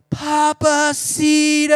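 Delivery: high, loud, drawn-out battle cry; yell. Retold or imitated. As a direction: holler it long, stretching every syllable. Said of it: "and then they yell".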